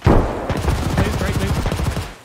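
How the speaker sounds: loud, high urgent shout; urgent shouted callout. Real, not acted. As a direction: yell orders mid-fight. Urgent callout under fire.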